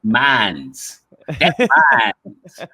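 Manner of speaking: demonstrating a Southern drawl on "mines"; playful Southern-accented demo. Shown as a demonstration. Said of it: "Southern tinge or a drawl".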